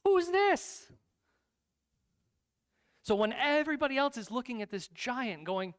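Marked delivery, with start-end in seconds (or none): 0.0-0.9 high, loud indignant squawk; incredulous challenge. Acted. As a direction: shout it in scornful disbelief. David challenges the feared giant.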